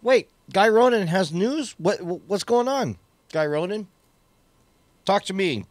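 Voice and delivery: high, loud excited surprise. Real, not acted. Sudden news during launch stream.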